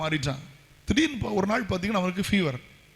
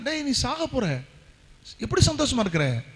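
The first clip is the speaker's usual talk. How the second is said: astonished question; 7 semitones higher than usual. Retold or imitated. Captain asks dying boy, astonished.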